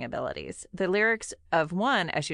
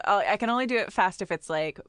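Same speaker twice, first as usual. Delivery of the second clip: ordinary delivery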